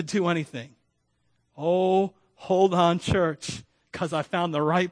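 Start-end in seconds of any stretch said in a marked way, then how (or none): none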